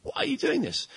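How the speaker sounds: high pleading why; pleading president. Retold or imitated. Preacher voicing the president begging the terrorist, high and pleading. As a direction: beg him to stop. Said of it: "threatening to kill his whole family".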